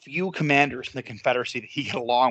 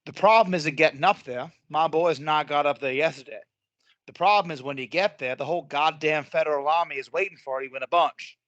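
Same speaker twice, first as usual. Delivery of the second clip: put-on Southern accent. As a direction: play a Confederate officer in a Southern accent. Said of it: "Good Southern accent there".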